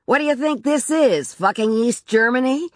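high-pitched sarcastic shout; irate, exasperated husband; angry shouting. A narrator's reading. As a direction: shout it as a scornful question. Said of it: "He was shouting".

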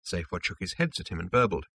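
plain reading voice

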